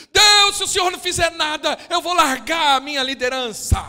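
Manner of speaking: high, loud threats at God; shouted threat. Retold or imitated. Believer at home threatening to leave the church, shouting. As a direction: shout it like a spoiled ultimatum. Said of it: "tem gente que faz isso em casa".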